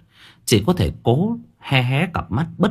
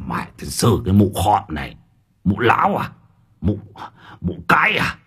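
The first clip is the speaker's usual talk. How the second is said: an old official's voice, lightly put on, spitting the words; hissing in rage. A narrator's reading. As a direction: spit the insults through his teeth at the old woman. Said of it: "Miệng của lão rít lên".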